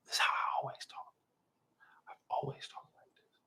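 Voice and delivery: near-whisper, very soft; hushed aside. Real, not acted. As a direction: drop to a whisper, almost no voice. Showing how he always talks.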